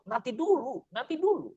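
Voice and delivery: high, gently teasing answer from above; voicing Allah's reply. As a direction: play the divine voice answering the prayer, pitched high. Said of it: "kata Allah".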